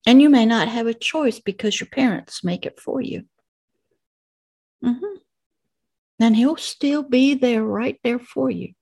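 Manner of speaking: normal talking voice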